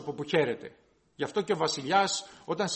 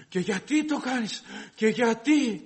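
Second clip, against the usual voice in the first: sharp, raised, indignant questioning; 4 semitones higher, slower than usual. Retold or imitated. Imitating an indignant person scolding someone, with a sharp raised voice. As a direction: demand it like an exasperated man scolding. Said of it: "η φωνή του είναι έντονη".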